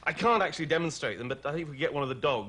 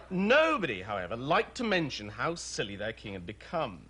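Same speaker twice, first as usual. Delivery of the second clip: ordinary talk, nothing marked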